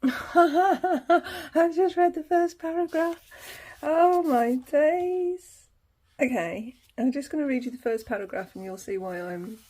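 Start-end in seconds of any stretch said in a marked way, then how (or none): 0.0-5.7 high, loud words through laughter; helpless laughter spilling into the words; real laughing. Real, not acted. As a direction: crack up while talking, drawn-out and high. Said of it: "I'm laughing now".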